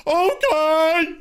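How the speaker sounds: very high, stretched falsetto okay; mock dim servant voice, way up high; squeaky chauffeur. Mocking chauffeur agreeing, falsetto.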